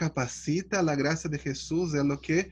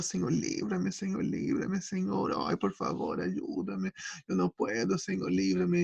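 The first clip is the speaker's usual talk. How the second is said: retold weeping prayer; 4 dB quieter than usual. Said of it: "cuántos años lloraba".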